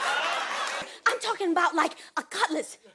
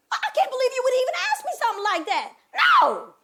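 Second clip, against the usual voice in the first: high, loud, fast protest building to a yell; indignant denial; 8 semitones higher, 8 dB louder than usual. Acted. Woman denying she is a sellout, highly offended. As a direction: protest in a rush, then explode.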